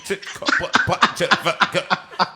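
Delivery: choppy broken-up words, laughing; mock signal dropout with stutters and laughs; faking a bad line. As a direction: pretend the phone line is cutting out. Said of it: "we're getting interference".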